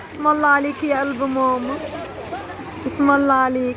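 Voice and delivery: soft soothing. Real, not acted. Soothing child softly.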